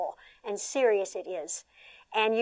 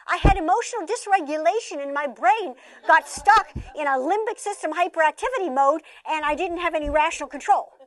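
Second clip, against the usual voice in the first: mock clinical kid voice; 6 semitones higher, 4 dB louder than usual. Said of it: "They don't know how to say".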